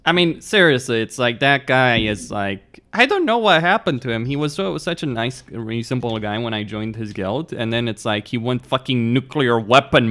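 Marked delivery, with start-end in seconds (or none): none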